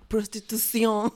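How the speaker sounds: slow, drawn-out mock foreign accent on "Prostitución"; flair of accent. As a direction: imitate him with a flourish of foreign accent. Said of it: "flare of an accent".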